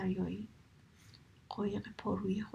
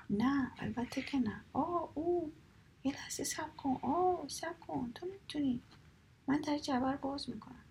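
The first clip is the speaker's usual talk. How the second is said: pained pleading; 7 semitones higher than usual. Acted. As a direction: beg him to stop while it hurts. Seagull in pain, gives in.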